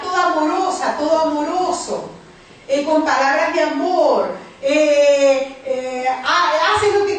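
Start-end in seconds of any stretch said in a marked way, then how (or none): none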